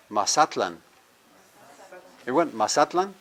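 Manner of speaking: Mazatlan with the weight on ZA; stress second-last syllable. Shown as a demonstration. Teacher having the class repeat, stress second-last syllable. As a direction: hit ZA in Mazatlan, final syllable light. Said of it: "stress the one before that".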